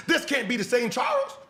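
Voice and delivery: ordinary talk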